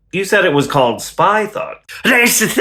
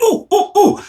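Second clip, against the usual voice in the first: mock eager ooh; 12 semitones higher than usual. Mock excited objection.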